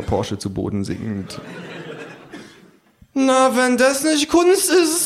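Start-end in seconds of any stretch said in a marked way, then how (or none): 3.2-5.1 high, slow self-satisfied kangaroo; smug kangaroo. Acted. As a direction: savour it like an art critic. (hohe, langsame selbstzufriedene Känguru-Stimme)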